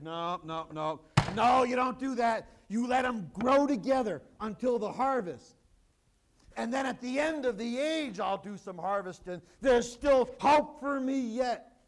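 loud, ringing voice of Jesus; booming quoted reply; voicing Jesus. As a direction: proclaim it boldly as Jesus.

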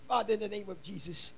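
low, soft pastoral prayer; hushed, rhythmic address to God. Real, not acted. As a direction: pray quietly and steadily over the congregation. Leads a prayer.

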